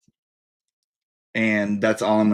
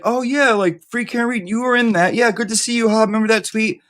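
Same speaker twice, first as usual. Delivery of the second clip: much higher, overly chipper small talk; awkward grocery-store chirpiness, far higher than usual; fake-friendly greeting; 11 semitones higher than usual. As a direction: gush like you barely know them. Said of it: "I'd be like".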